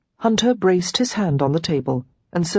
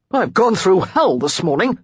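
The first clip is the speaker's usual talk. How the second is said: annoyed outburst; 3 semitones higher, 4 dB louder than usual. A narrator's reading. Hunter annoyed chasing her.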